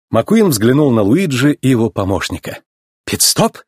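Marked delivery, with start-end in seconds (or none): 3.1-3.6 high, hopeful little question; tentative, high-voiced hope. A narrator's reading. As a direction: ask eagerly in a raised pitch. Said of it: "с надеждой спросил Гвидо".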